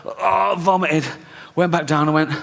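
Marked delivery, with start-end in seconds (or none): none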